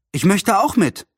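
high-pitched eager request; understated childlike plea; pleading. A narrator's reading. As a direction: beg lightly to come along. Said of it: "bat Anne".

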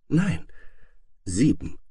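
put-on light child's refusal; whispering little princess. A narrator's reading. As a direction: answer timidly, as if whispering. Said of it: "flüsterte Lisi".

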